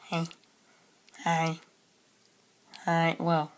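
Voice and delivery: ordinary talk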